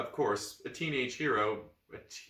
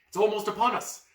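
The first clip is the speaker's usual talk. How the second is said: urgent battle plan; 8 semitones higher, 7 dB louder than usual. Acted. Boy planning ambush.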